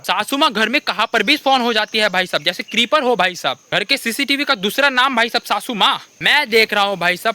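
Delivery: ordinary delivery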